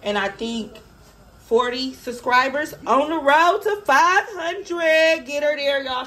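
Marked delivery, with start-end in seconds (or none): 2.9-6.1 hyping up. Real, not acted. Hyping subscriber goal.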